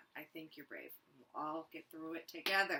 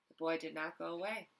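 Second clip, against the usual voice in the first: ordinary delivery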